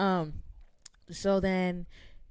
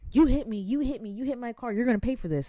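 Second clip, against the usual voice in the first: louder, higher accusing rant; angry accusation; 5 semitones higher, 6 dB louder than usual. Retold or imitated. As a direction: throw the blame at her, loud. Lady accusing and threatening.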